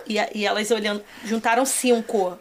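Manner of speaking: usual speaking voice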